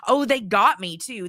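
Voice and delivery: ordinary delivery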